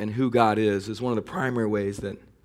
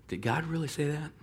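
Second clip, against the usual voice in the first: sly doubting question; 4 semitones higher, 10 dB quieter than usual. Retold or imitated. Satan's whispered question of doubt.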